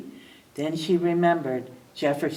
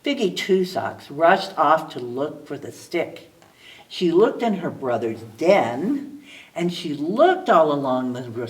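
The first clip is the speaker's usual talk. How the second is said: usual speaking voice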